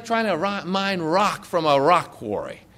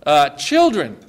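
ordinary talk, nothing marked

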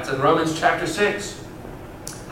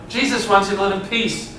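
normal talking voice

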